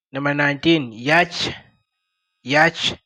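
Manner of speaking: ordinary talk, nothing marked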